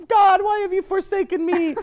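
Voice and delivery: very high mock lament; doomed mouse. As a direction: cry out as a tiny martyred mouse. Voicing doomed mouse in a drawing.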